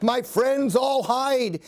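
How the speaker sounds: plain reading voice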